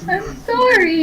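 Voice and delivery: high, laughing "I'm sorry"; sorry, giggling with embarrassment; embarrassed laughing apology. Real, not acted. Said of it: "Blushing so hard right now".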